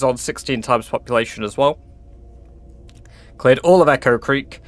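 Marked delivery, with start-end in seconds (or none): none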